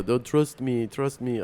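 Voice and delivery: put-on botched French accent that lands near Russian; bad French accent. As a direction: attempt a French accent and miss. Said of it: "I don't have a good French accent".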